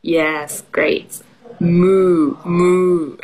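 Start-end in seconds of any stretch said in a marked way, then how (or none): 1.6-3.2 playful sustained moo; cow noise. Shown as a demonstration. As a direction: demo moos held long. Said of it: "what noise does the cow make".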